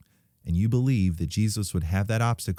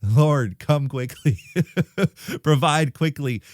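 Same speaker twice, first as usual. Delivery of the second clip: comic cry for help; laughing plea; 6 semitones higher, 5 dB louder, slower than usual. Retold or imitated. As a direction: mock-desperate prayer, higher and louder. Jokey prayer about failing truck.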